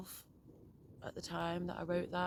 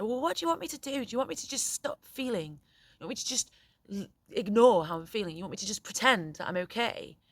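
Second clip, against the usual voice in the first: put-on high, fast, upset teenage protest; upset son; 4 semitones higher, 6 dB louder than usual. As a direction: voice the son's hurt, pleading challenge. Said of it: "as I'm performing it for you now".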